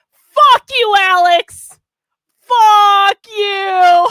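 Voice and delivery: loud, high drawn-out shout; delighted yell. Real, not acted. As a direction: yell it at a donor, stretching the words. Yells at donor after big donation.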